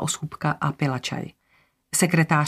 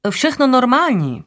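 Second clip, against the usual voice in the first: high cleaner voice; 8 semitones higher, 5 dB louder than usual. A narrator's reading. Cleaner's brisk answer, voiced.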